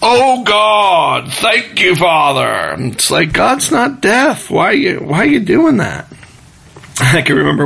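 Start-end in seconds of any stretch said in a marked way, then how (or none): 0.0-2.8 higher, louder mock shouted prayer. Preacher mocking a man who shouts his prayers, hammed-up yell. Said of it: "their volume increases by, like, two times". 3.3-6.0 exasperated. Real, not acted. Exasperated reply to yeller.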